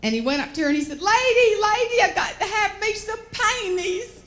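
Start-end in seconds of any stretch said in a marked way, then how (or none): 1.0-4.2 loud, high-pitched frantic country man; imitating a good old boy in a panic; panicked farmer. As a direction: holler urgently like a flustered South Georgia farmer. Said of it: "was in a little bit of a panic".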